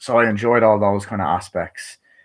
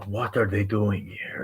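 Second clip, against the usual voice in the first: hushed quote; 6 dB quieter, slower than usual. Retold or imitated. Villain's worried question.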